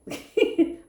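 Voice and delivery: high breathy giggle; giggle burst. Real, not acted. Tarot reader cracking up at a word, breathy giggle. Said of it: "I'm laughing because".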